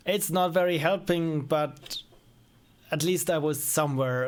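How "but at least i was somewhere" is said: ordinary talk, nothing marked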